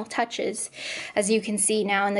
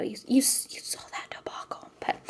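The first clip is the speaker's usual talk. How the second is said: soft conspiratorial whisper; whispered aside; 8 dB quieter than usual. Real, not acted. Telling viewers about the debacle, in a whisper. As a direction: let the viewers in on a secret.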